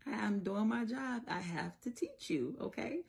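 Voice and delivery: imitated mom asking to be understood; mommy to child. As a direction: explain it to a small child with patient insistence. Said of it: "this is me".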